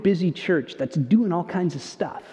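ordinary talk, nothing marked

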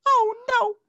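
squeaky high-pitched mock distress; high mock wail.

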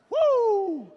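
loud high celebratory whoop; whooping woo. Pretend. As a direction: whoop it out like a party cheer. Emoji for the wedding night.

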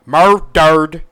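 shocked loud repetition; loud emphatic repeat. Shown as a demonstration. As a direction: belt out Murdered for emphasis. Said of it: "He gets murdered".

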